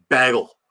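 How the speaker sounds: "bagel" said as "baggle"; short a in "bagel", as in "bag"; mocking mispronunciation. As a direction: tease with "bagel" rhyming with "haggle". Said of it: "He said bagel".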